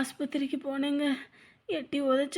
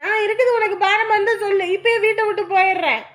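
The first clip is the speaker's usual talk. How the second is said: loud, high threat to leave; angry shout; 6 semitones higher, 8 dB louder than usual. Acted. Daughter shouting she will leave home, loud and high. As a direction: fling it at her mother.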